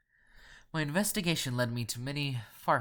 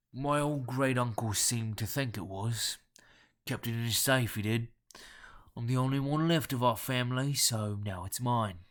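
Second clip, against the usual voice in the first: guttural nasal Cockney; 4 dB louder than usual. A narrator's reading. Caller explaining his inheritance, in guttural Cockney. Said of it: "guttural yet nasal Cockney accent".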